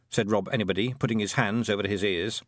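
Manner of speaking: ordinary delivery, nothing marked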